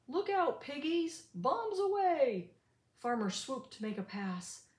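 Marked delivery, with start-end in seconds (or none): 0.1-0.6 gleeful warning. Acted. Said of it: "Farmer cried". 0.6-2.6 gleeful cry. Acted. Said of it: "Farmer cried".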